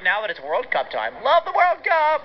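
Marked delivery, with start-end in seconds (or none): 1.2-2.2 excited. Real, not acted. Host gushing about World Cup.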